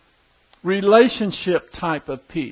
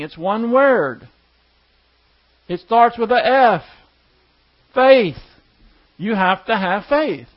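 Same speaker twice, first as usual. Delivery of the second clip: preaching; 4 semitones higher, 5 dB louder than usual. Acted. Preaching builds to faith.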